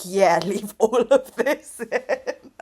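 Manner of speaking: slow, laughing whisper. Retold or imitated. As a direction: whisper it back, giggling. Quoting Tyler's reply.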